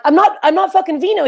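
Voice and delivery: irritated rising not; annoyed denial. Retold or imitated. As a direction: push back, fed up with the stranger. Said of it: "I'm like".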